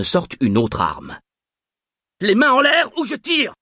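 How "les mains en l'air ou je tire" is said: shouted threat. A narrator's reading. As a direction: yell the threat, ready to fire. Guard threatens to shoot.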